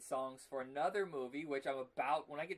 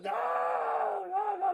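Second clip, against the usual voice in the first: screamed high and loud in a crying wail; Nicolas Cage scream; 12 dB louder, much slower than usual. Retold or imitated. Mimicking the actor's meltdown scene, screaming high and loud. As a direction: wail it out like an unhinged star losing it. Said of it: "You're just screaming and yelling".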